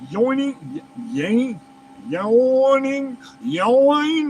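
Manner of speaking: drawn-out failed attempts at "Yoing"; stumbling pronunciation. Real, not acted. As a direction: struggle through "Yoning, Yang, Yoing", stretching each attempt. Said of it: "I don't know how to fucking pronounce it".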